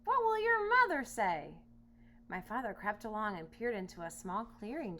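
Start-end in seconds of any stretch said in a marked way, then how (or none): 0.0-1.5 high, loud exasperated lion voice; angry lion scolding himself. Acted. Lion yelling at himself over his mane, high and loud. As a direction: scold yourself like a fed-up lion.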